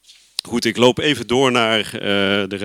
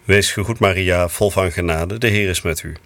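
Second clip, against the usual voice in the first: low, even murmured Hail Mary; prayer recitation; 5 semitones lower than usual. Real, not acted. Priest leads the Angelus on air, lower prayer recitation. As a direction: recite the prayer steadily, devoutly.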